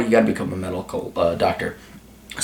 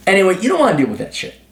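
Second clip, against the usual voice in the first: ordinary delivery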